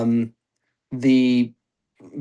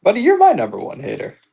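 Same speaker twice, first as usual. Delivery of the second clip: teasing mimic; 8 semitones higher, 3 dB louder than usual. Teasing mimic of Frank's words.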